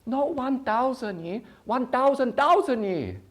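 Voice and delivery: high, insistent broken-English lecture, slow and loud; mock Chinese dad. Father correcting, voiced high.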